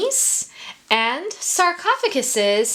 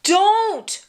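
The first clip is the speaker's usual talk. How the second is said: pleading cry; 8 semitones higher, 4 dB louder, slower than usual. A narrator's reading. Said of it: "cried Bill".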